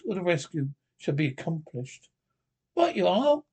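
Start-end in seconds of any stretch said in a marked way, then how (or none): 2.7-3.4 high eager exclamation; eager cry. A narrator's reading. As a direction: jump up keen to go. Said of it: "cried the Rat, starting up".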